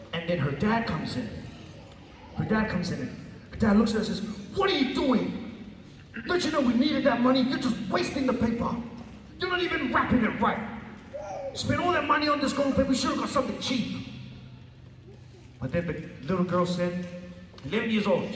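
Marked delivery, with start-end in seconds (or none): 4.6-14.1 high, scolding dad; angry father scolding. Retold or imitated. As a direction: scold her for wasting the money. Dad scolding daughter in story.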